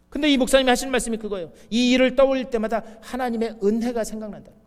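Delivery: normal talking voice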